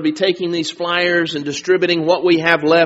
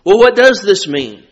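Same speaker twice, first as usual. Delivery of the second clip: ordinary talk, nothing marked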